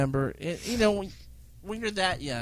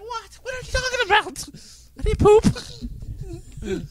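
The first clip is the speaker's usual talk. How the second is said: put-on very high, loud, slow crying child voice; hurt child's protest; 6 dB louder, slower than usual. A child crying back at teasing relatives, very high wail. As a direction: wail like an upset little kid denying it. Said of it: "I'm like".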